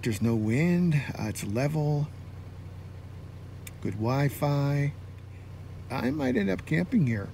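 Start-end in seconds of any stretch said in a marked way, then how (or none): none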